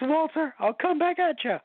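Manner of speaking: high character voice. Pretend.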